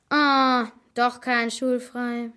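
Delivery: drawn-out, playfully dismayed moan; mock horrified whine. A narrator's reading. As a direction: whine slowly in pretend horror, with a loud opening "Ah". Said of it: "jammerte Helena mit gespieltem Entsetzen".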